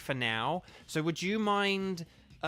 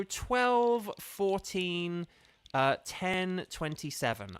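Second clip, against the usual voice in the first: slow dictation; 3 semitones higher, much slower than usual. Real, not acted. Reading out a room code.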